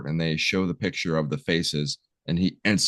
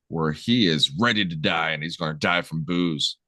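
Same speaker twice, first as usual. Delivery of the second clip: ordinary talk, nothing marked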